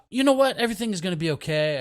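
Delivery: high, loud, quick mock pep talk; upbeat consoling. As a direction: brush the worry away, loudly and briskly. Acts out cheering up a sad friend.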